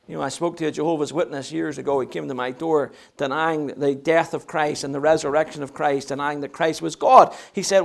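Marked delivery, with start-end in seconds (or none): none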